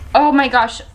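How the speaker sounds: ordinary talk, nothing marked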